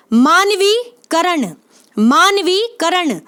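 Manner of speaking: मानवीकरण drawn out slowly, each syllable clear; slow spelling demo. Shown as a demonstration. Teacher slowly sounding out मानवीकरण for students. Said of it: "मानवीकरण बोल के देखें".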